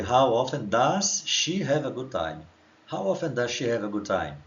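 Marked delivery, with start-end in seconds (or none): 0.0-2.5 very slow, every word spelled out for students; slow model reading. Shown as a demonstration. Teacher reading the question slowly to students. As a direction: read it slowly for the class to copy. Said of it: "Então a pergunta aqui em cima já foi".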